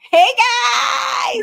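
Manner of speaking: high, drawn-out delighted call; excited greeting. Real, not acted. Mother greets her own children on the show, excited high call. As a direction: squeal hello as the guests appear.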